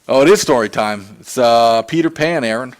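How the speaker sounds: usual speaking voice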